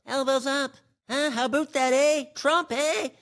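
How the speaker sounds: put-on high, loud cocky Canadian accent with eh; mock Canadian accent. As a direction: strut like a proud hockey fan, Canadian accent. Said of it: "everybody was elbows up".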